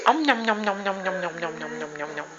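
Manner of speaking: mock gobbling, fading to a hush; playful munching noises. Pretend eating baby's chin.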